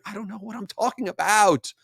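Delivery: high-pitched comic wail; playful meltdown, voice shooting up high; mock exasperated outburst. Mock outburst at own rambling.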